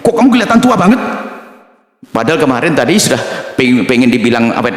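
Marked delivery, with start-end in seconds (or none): 0.0-1.0 tactless friend. Retold or imitated. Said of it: "pasti sisi negatifnya".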